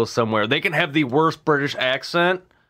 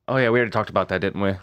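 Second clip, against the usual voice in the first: ordinary delivery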